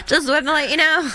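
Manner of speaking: high-pitched, showy broadcasting; mock announcement. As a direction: announce yourself to everybody, hammed up and high. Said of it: "didn't run around going".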